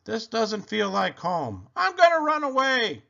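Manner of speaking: high wail building to a loud cry; young dinosaur's storybook wail. A narrator's reading. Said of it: "And he cried".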